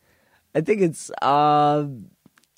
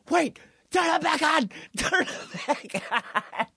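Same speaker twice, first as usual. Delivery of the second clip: high mock-alarmed plea; Vince McMahon panic; 13 semitones higher than usual. Boss hears the wrestler's theme, high mock panic. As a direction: imitate a boss suddenly panicking.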